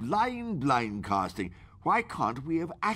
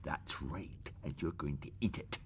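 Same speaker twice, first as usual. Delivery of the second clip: low, soft and menacing; menacing quiet threat; 6 semitones lower, 11 dB quieter than usual. Acted. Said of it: "You were very menacing".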